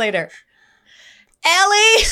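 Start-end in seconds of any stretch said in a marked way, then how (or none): 1.4-2.0 very high, loud holler; piercingly loud imitation of a clerk's call; yelled name call. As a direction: shout it across the counter. Clerk calling out her order name.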